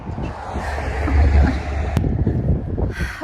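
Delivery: slow breathy whisper of wonder; whispered awe. Real, not acted. Vlogger overwhelmed by the lake, slow hushed whisper. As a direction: breathe it out, barely voiced.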